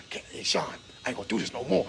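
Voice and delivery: hushed whisper, barely voiced. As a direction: whisper it like a secret threat. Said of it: "I was whispering".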